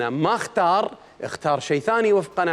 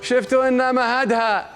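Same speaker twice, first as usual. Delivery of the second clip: slow, raised chanted verse; poem recitation; 7 semitones higher, slower than usual. Acted. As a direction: intone the poem line slowly. (إلقاء شعري بطيء)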